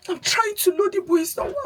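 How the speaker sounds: high, tearful whine, imitating a crying boy; crying son. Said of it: "is that why you're crying?".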